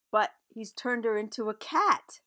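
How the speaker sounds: usual speaking voice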